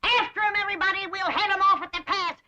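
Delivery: high-pitched call to arms; rallying cry. Acted. Granny rallies the family after the runaway groom, urgent cry. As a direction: call everyone out to give chase.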